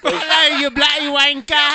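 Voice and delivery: loud, high British greeting; mock English accent. Co-host greeting the caller, mock English shout. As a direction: holler hello in fake English. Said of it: "in an English accent".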